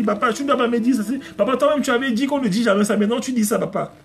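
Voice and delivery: put-on high-pitched protesting child; imitated daughter. Daughter telling papa off for breaking his own rule, imitated child voice. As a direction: pitch it up like a child scolding Dad. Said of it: "c'est ma fille, ça".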